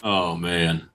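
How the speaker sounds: usual speaking voice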